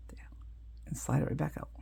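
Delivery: soft whispered step while concentrating. Real, not acted. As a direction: whisper it as the hands move. Softly showing a hand move.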